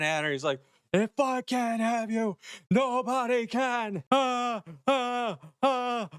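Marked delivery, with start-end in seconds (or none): 0.9-4.0 high, slow and drawn out, a hammed-up killer; imitating a deranged lover's shriek; mock psycho scream. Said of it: "He's just screaming and screaming". 4.1-6.1 high repeated hammed-up shrieks; imitated frenzied yells; mock screams. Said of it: "He's just screaming and screaming".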